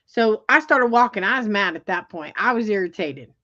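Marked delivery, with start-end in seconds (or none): none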